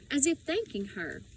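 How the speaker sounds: ordinary delivery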